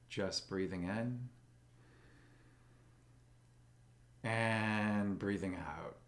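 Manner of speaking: slow, calm meditation guide with long pauses; soothing, even voice, leaving space for breath; guided meditation. Acted. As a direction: guide them gently into stillness, unhurried.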